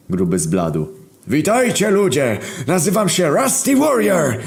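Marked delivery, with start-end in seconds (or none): 1.3-4.4 put-on heavy German accent, booming introduction; strong German accent. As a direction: greet them like a proud German machine. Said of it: "z mocno niemieckim akcentem".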